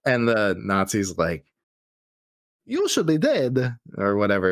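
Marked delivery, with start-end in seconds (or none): 2.7-3.8 hammed-up bad German accent. Movie Nazi facing the unkillable hero, bad German accent. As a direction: attempt a clumsy German villain accent. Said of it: "that was a bad German accent".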